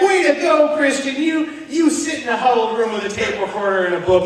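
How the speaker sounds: high, sarcastic pat on the back; mock congratulations. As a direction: cheer yourself on mockingly. Mock praise to himself.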